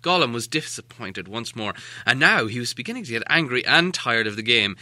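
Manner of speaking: ordinary delivery, nothing marked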